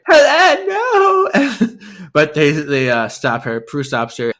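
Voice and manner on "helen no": much higher, loud crying shout of her name; wailing no, sobbing; anguished cry. Retold or imitated. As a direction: scream for her sister in despair. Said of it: "Gabriel's like".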